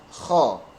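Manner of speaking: KHO with a raspy back-of-tongue scrape; rough kh. Shown as a demonstration. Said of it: "Tetapi suaranya kasar". (kho dengan suara kasar)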